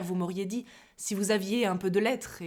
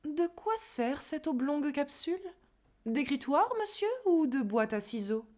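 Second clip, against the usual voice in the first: higher, inquisitive questioning; mock-innocent prying question; curious; 6 semitones higher than usual. (curieux et interrogateur)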